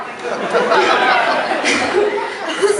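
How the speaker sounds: high, loud burst of laughter. Real, not acted. Recalling the judge's ultimatum, a loud high laugh. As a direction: laugh out at the absurd memory.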